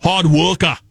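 hammed-up Boston accent; mock Boston accent, said as a punchline. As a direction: do a confident Bostonian, dropping the r's. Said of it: "most confident accent is Boston".

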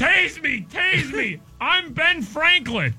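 high, slow mock yell; crazed yell. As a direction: yell it like a madman. Quoting it loud and high.

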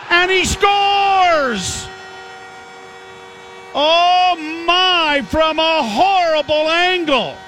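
high, drawn-out shouted goal call; excited goal call. Real, not acted. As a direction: belt out the goal, stretching it.

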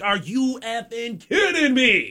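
high, slow, quiet incredulous groan; exasperated disbelief. Retold or imitated. Hearing his girlfriend is stuck too, slow exasperated disbelief. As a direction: stretch it out in disbelief.

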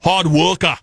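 lightly put-on Boston accent; a hint of mock Bostonian. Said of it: "The most confident accent is Boston".

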